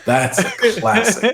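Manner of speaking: high, mocking "That's a classic"; teasing falsetto echo. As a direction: repeat it in a silly squeaky tone. Mocking repeat of own phrase.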